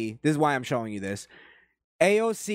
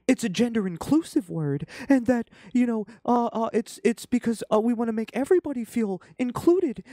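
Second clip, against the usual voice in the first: high-pitched mock explainer, halting; put-on high voice, stammering through excuses; mock explaining; 8 semitones higher than usual. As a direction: imitate an earnest explainer in a high voice. Voices activists explaining the term.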